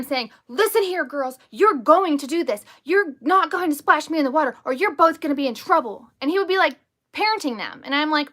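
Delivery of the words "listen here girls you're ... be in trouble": bossy, raised-pitch telling-off; mock scolding. Stepson ordering his siblings about, scolding. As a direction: imitate a child lecturing his siblings like a parent. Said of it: "scolding them".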